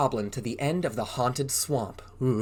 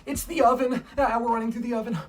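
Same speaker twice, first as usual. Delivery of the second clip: high, urgent mock panic; playful high-pitched fright; pretend alarm; 8 semitones higher, 3 dB louder, faster than usual. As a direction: cry out at a make-believe danger. Play-acts alarm at running through oven.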